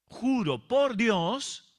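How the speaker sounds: a hint of the angry king swearing by God; mock oath. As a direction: stretch each word like a king vowing. Preacher voices the king's oath.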